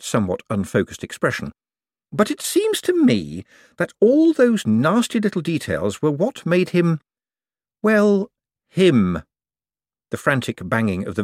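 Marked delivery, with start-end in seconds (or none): 2.1-9.2 high, careful argument with hesitation; higher-pitched, tentative reasoning; Moist objecting. Acted. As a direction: feel your way delicately to the point.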